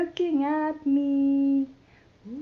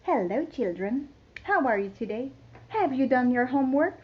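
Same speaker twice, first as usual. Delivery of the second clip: teacher voice; 5 semitones lower, 3 dB quieter, much faster than usual. Acted.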